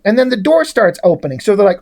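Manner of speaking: normal talking voice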